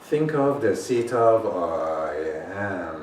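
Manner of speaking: slow, drawn-out, intent meditation voice; unhurried spiritual teacher; slow meditation guide. Acted. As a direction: guide them inward, slowly. Leads a meditation.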